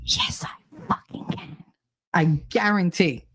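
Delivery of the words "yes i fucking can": breathy mock whisper of a comment. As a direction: read the comment in a hushed whisper. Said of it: "Uh, whispers".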